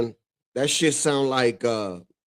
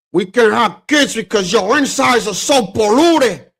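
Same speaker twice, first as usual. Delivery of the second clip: loud, higher imitation of Tony Montana's harsh line; Scarface quote; 7 semitones higher, 8 dB louder than usual. Retelling Scarface to viewers, loud mock gangster line. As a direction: belt out the movie line like the gangster. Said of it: "when he said".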